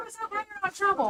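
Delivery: high, soft pet-talk; gentle high-pitched reassurance; reassuring a dog. Real, not acted. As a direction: soothe a scared dog in a small high voice. Soothing her scared dog.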